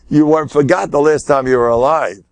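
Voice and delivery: ordinary talk, nothing marked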